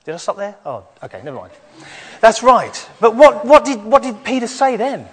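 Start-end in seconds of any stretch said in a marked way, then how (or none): 2.2-5.1 loud call-out. Real, not acted. Calling out question to children.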